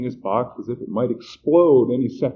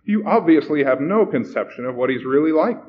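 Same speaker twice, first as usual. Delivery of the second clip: loud, raised, impatient rebuke; testy; 5 semitones higher, 6 dB louder than usual. A narrator's reading. Stern man correcting the flustered young host, testy. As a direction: put them down irritably. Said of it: "the man said testily".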